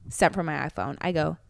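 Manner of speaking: ordinary delivery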